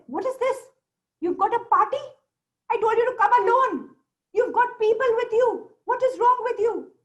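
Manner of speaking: high, loud, indignant outburst; put-out jinn demanding an explanation; surprised, angry jinn. Acted. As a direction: scold them in high-pitched disbelief. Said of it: "the jinn was surprised".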